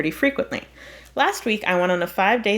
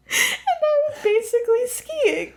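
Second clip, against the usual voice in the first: very high, squeaky laughing speech; real cracking up; slower than usual. Real, not acted. Reader losing it over the skiing excuse, cracking up. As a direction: force the slow words out through helpless laughter.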